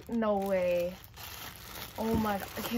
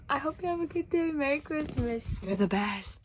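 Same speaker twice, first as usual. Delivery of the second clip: normal talking voice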